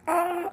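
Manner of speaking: high, loud startled scream; a short frightened shriek; scream of fright. Retold or imitated. Retells his cry of fear.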